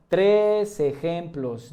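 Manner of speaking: TRES drawn out slowly and stressed; emphatic insistence. Real, not acted. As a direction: stretch out "Tres" so the number sticks. Insisting on three, not two.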